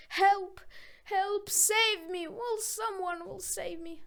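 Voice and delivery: loud, high panicked scream; screaming for help. A narrator's reading. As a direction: cry out terrified in the dark. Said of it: "he cried and screamed".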